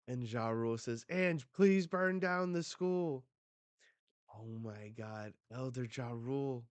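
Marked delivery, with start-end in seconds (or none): 1.1-3.2 raised, higher-pitched plea; acted desperate request, pitched up; pleading. Elder begging them to burn the school, pleading.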